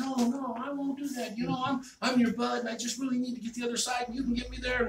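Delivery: high, hurried pleading; wheedling scorpion. Acted. Scorpion talking the frog into a ride, wheedling.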